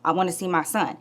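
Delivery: low, soft imitation of a calm man. Baby's father answers the mother, calm. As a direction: answer quietly and evenly. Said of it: "It was very calm".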